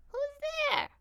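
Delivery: put-on high old woman calling out; frail old grandmother. A narrator's reading. Grandmother calling to the door, high and loud.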